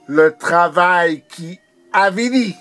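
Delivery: urgent poetic proclamation; insistent refrain. Acted. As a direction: drive the refrain upward with force. Poem refrain repeated more intensely.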